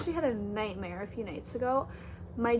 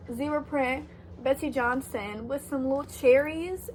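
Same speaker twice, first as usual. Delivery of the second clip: normal talking voice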